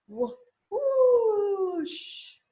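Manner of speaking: high, loud, long whoosh; whooshing wind. A narrator's reading. Bird and Rocket spell the cold wind, whooshing. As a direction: swoop the voice like a gust. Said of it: "They spelled the sound".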